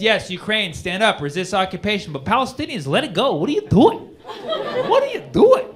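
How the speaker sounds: high mock politician, rising into a squeak. Comic mocks politicians' double standard, rising squeak. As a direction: rant it like a hypocritical politician. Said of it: "What you most often hear is".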